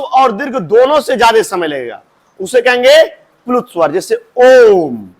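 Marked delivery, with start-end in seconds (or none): 4.4-5.1 drawn-out Om; ओम stretched out as a long pluta vowel; prolonged Om. Shown as a demonstration. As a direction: hold the ओम long and slow, like a chant. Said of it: "ओम सबसे ज्यादा नहीं time लग रहा है".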